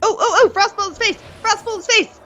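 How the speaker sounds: fast, high, gleeful; excited shout. Real, not acted. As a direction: squeal at the spectacle. Excited at game animation.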